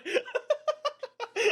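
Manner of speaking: high squealing burst of laughter. Real, not acted. Podcaster laughing at his own retelling, a high squealing laugh.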